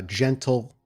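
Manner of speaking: normal talking voice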